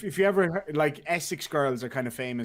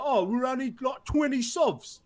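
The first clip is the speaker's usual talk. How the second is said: much higher-pitched, broad Cockney imitation; really Cockney; 10 semitones higher than usual. Imitating a Cockney co-worker talking money, in broad Cockney. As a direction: put on a thick Cockney voice. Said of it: "Like, really fucking Cockney".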